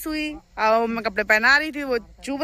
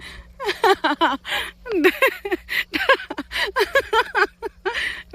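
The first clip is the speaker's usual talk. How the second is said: startled laugh; 10 semitones higher than usual. Real, not acted. Cow charges at her.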